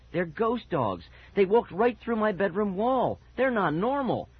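high, agitated insistence; insistent cry. A narrator's reading. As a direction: cry it out, pitch climbing. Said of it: "Mickey cried".

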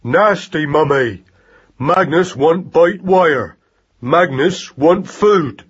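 angry shout. A narrator's reading. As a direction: demand it in furious baby talk. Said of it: "He shouted angrily at his mother".

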